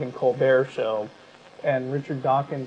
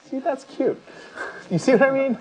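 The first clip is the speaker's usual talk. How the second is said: amused aside; 10 semitones higher than usual. Real, not acted. Mocking aside on British understatement.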